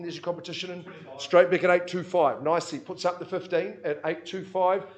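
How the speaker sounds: loud, rhythmic auctioneer calling bids; auction bid spotter. Acted. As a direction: drive the room, call each bid out.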